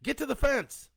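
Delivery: loud, high-pitched urgent order; yelling. A narrator's reading. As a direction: yell the children on to safety. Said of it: "he yelled at them".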